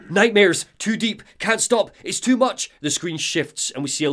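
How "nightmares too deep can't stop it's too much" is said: high, loud panicked cries, stopping short of a scream; acted screaming. Storyteller voicing Alan trapped in nightmares, screaming. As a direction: cry out in overwhelmed bursts. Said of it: "we hear Alan screaming".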